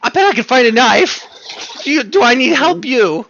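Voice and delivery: high, very loud imp voice; imp snapping out of it. Acted. Imp breaking off its prayer to talk, high and loud. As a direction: pipe up loudly as the little imp. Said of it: "The imp snaps out of it and goes".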